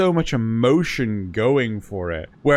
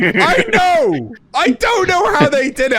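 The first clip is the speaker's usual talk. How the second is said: much higher, louder laughing outburst; laughing disbelief; 14 semitones higher, 5 dB louder than usual. Real, not acted. Laughing to a friend about terrible dub accents, high and loud.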